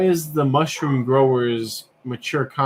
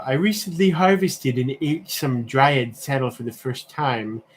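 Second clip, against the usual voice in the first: reading a Reddit post in an unnamed put-on accent; 3 semitones higher than usual. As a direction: ham up the unnamed accent for the comment. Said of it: "I don't know why I'm doing this accent".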